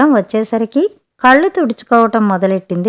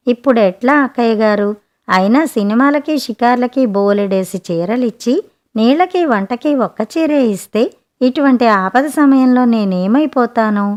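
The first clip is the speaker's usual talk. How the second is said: plain reading voice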